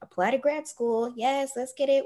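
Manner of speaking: high-pitched, game-for-it yes to God; eager agreement. Retold or imitated. Speaker saying yes to God about moving, eager agreement. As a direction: go along eagerly, voice lifted. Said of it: "I was like".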